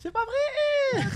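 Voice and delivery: very high, drawn-out squeal; high-pitched stretched exclamation; excited disbelief. Real, not acted. Amazed by the meal delivered.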